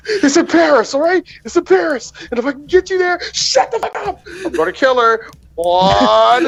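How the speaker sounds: high-pitched frantic movie-hero threat; hammed-up impression of a panicking action star; Tom Cruise. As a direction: imitate desperate bargaining, voice way up. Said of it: "Tom Cruise was doing his best Tom Cruise".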